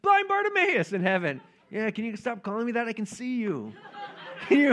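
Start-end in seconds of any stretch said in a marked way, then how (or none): 0.0-0.9 high-pitched mock call to BARTIMAEUS; crowd calling out. People in heaven hailing him by his old name, high mock call. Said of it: "people are like". 1.7-3.7 higher, quick comic protest; mock annoyed retort. Healed man in heaven tired of his nickname, mock annoyed.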